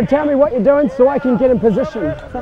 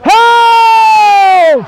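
long shouted call; 6 semitones higher, 5 dB louder than usual. Real, not acted. Referee holding the play.

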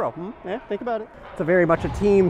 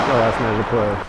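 glum, lower read of last minute of play; sad announcer; 7 semitones lower than usual. Shown as a demonstration. Goalie contrasting announcer versions for viewers, sad call. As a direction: sigh out the last minute of play like a defeated broadcaster. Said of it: "It's like the sad".

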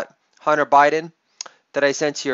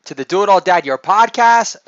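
ordinary delivery

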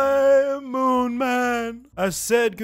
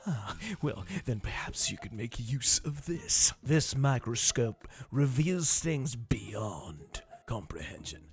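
low sinister voice; 4 semitones lower, 6 dB quieter than usual. Pretend. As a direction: draw it out darkly. Devil shopkeeper impression.

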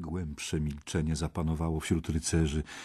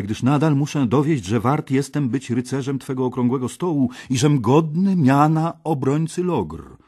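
louder, higher earnest request; eager knight; 7 semitones higher, 6 dB louder than usual. A narrator's reading. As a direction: plead for the adventure. Gawain asks for quest.

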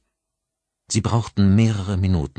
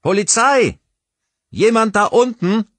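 high, full-volume police call; loud shout; 8 dB louder than usual. A narrator's reading. Policeman calling down into the shaft, shouting as loud as he can. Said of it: "Er rief so laut er konnte".

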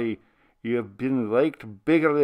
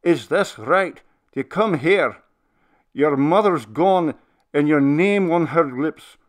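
put-on loud, much higher remonstrating old minister; expostulating; 6 semitones higher, 9 dB louder than usual. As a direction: scold the faint-hearted spirit, hurt and indignant. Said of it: "His voice took on a tone of expostulation".

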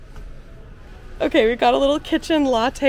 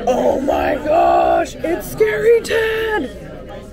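excited shout; 6 semitones higher, 5 dB louder, much slower than usual. Acted. Reveals a pin it calls scary.

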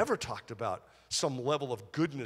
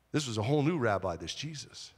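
usual speaking voice

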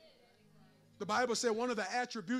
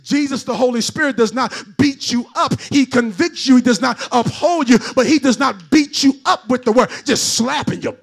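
louder preaching swell; preaching, building; 22 dB louder than usual. Acted. Preacher driving the point home to the church, loud. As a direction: lift it into a preached run.

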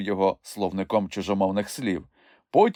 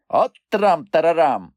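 loud, higher amazed shout; astonished cry; 8 semitones higher, 6 dB louder than usual. A narrator's reading. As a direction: gasp at the spell. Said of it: "вражено скрикнув Мумітроль". (вражений скрик)

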